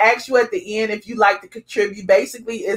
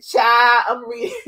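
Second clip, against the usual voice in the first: sassy gossip. Retold or imitated. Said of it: "she said".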